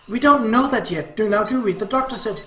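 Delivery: pleading objection in an argument; anxious protest. Acted. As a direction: push back, voice lifted. Mother protesting in the quarrel.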